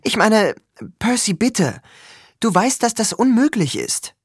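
high, urgent plea; panicked pleading. A narrator's reading. Annabeth begging Percy to refuse Tyson, panicked. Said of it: "hatte jetzt Panik in der Stimme".

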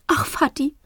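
high, teary sigh of a mother; a mother's high, tearful sigh; smiling through tears. A narrator's reading. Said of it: "lächelt unter Tränen".

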